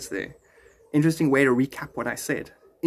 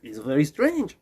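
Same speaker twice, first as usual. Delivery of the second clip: mild put-on French accent; musing in a light French accent, with pauses. Border visitor puzzled by his changed speech, mild French accent. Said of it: "it makes me speak French".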